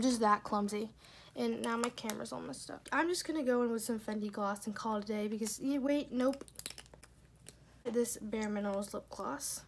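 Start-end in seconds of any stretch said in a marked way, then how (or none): none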